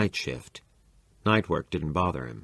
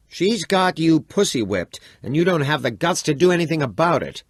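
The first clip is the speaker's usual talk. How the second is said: furious taunt; 10 semitones higher, 7 dB louder than usual. Acted. Chico jeers at his father, loud and furious. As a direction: spit it at him with scorn.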